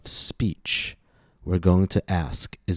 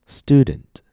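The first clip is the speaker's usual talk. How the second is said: "Student" with a high, long first vowel; stress on first syllable; 6 semitones higher, 3 dB louder than usual. Shown as a demonstration. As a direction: punch the start of "Student", then drop. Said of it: "the first syllable is stressed".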